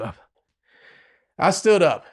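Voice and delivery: usual speaking voice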